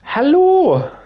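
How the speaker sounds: very high, loud drawn-out hello; sing-song hello. Real, not acted. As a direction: call out a bright greeting to a chatter. Greeting a viewer on stream.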